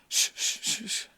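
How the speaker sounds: gentle repeated shushes; comforting shushing. Acted. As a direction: hush someone held in your arms. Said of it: "te abraza y te guarda y te dice". (arrullo suave para calmar, shh)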